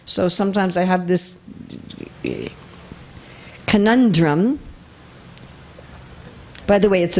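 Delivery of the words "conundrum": ordinary delivery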